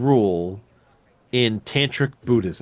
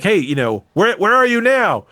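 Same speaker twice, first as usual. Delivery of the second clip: mock angry yell at the brother, rising high and louder; half-acted shout, accusing; imitating a yell; 8 semitones higher, 4 dB louder than usual. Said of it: "starts yelling at his".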